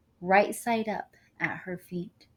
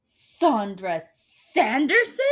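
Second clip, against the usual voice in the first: surprised; 5 semitones higher, 7 dB louder, slower than usual. A narrator's reading. Neighbor recognizes the star.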